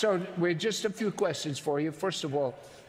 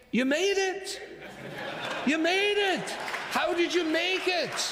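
higher astonished "You made it"; amazed; 11 semitones higher than usual. Real, not acted. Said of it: "astonished at the thief".

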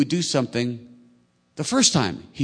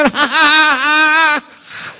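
high shrieking witch laugh; witch cackle; 12 dB louder, much slower than usual. Acted. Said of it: "the young girl had a witch voice".

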